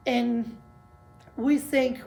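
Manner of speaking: ordinary talk, nothing marked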